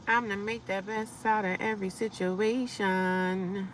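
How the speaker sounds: usual speaking voice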